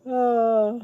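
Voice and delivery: speaking mid-yawn, loud and stretched. Real, not acted. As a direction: let a yawn break through the words. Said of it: "I'm sorry, I'm yawning again".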